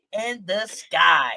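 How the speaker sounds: ordinary delivery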